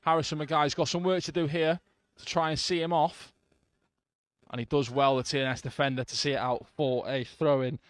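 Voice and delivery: live football commentary; play-by-play commentary. Real, not acted. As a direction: call the match as it happens.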